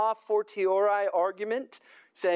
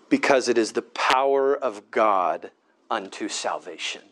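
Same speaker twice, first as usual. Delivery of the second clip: preaching at full force; 10 semitones lower, 6 dB louder than usual. Acted. Preacher warning the congregation about trusting Egypt, forceful. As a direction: drive each "not" home from the pulpit. Preacher builds to the New Testament answer.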